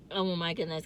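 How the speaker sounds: ordinary talk, nothing marked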